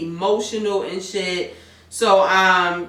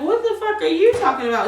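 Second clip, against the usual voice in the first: mock outraged question; 8 semitones higher, faster than usual. Liv snaps back, retold.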